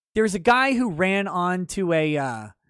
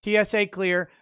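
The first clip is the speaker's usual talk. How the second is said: ordinary talk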